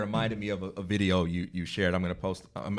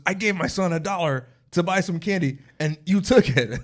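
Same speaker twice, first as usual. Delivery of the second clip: high-pitched imitation of an indignant father; mock outrage, getting louder at the end; retold accusation; 8 semitones higher, 5 dB louder than usual. Father accuses cashier over son's dollar, mock outrage.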